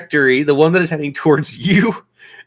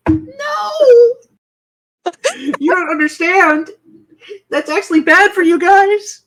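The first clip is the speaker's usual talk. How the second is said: high, squeaky protest; high-pitched protest. Real, not acted. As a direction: protest in a much higher voice. GM protests own lucky roll.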